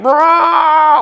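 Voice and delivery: high, held siren wail; drawn-out siren imitation; imitated screaming siren. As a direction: mimic the siren with a long high "raaah". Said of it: "So it's screaming".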